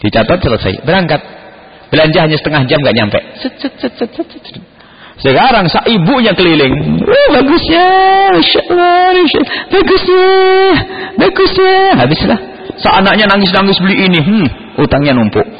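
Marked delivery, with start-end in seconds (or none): 3.4-4.6 soft, whispery "set-set-set" noise; quick string of hushed swishes; imitated swishing. Preacher mimics a half-hour shopping trip, hushed swishing. 7.0-11.7 high-pitched mock oohing over goods; gushing shopper. Preacher mimics a mother browsing the mall, high mock gushing.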